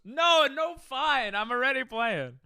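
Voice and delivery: loud, high-pitched protest; exasperated yelp of giving in; flustered outburst. Real, not acted. Flustered outburst at being toyed with.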